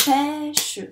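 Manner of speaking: "Pêche" loud and drawn out in two beats, pê-che; split into syllables. Shown as a demonstration. Teacher correcting the syllable count, "Pêche" split in two. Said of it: "J'ai donc deux syllabes".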